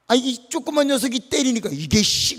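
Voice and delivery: high, loud storytelling outburst; worked-up, hammed-up indignation; mock indignant boys. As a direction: act out big kids affronted by a little one. Story of older boys kicked by youngster.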